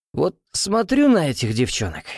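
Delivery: put-on slightly slurred, hoarse drunk voice; slurring, drunk. A narrator's reading. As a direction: talk a bit drunk and husky. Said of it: "слегка заплетающимся языком и хрипловатым голосом".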